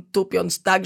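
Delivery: plain reading voice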